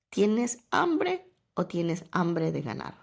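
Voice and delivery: slow deliberate question, stressing hambre; husband's challenge. Retold or imitated. Husband pushing her to train, slow pointed question. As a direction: lay out each word like a pointed challenge. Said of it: "Y me dijo".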